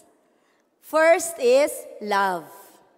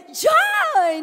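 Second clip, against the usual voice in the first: high, loud burst of joy; joyful shout; 8 dB louder than usual. Shown as a demonstration. Teacher shows the class how joy sounds, high happy shout. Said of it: "let's say it with emotion".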